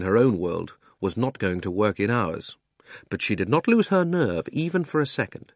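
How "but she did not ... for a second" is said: ordinary delivery, nothing marked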